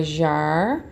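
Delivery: jarra split into jar-ra with a break between the Rs; syllables split. Shown as a demonstration. Teacher models separating the syllables of jarra. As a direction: say jar, pause, ra. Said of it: "como que você vai separar".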